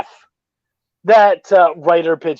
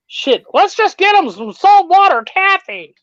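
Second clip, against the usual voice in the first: mock exasperation; 10 semitones higher than usual. Mocking old-fashioned candy choices.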